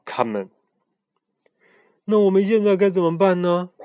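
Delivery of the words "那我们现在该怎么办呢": frightened asking. Acted. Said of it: "惊恐地望着皮迪克问".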